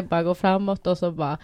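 usual speaking voice

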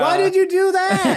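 yelling over the wind. Pretend. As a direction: shout it over rushing wind. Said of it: "Why are you two yelling?".

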